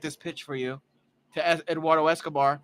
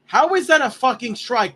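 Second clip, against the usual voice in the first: indignant burst, higher and louder; outraged protest; 6 semitones higher, 6 dB louder than usual. Real, not acted. Watch-along host disputing the umpire's call, outraged. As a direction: protest the call, loud and incredulous.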